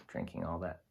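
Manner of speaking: ordinary delivery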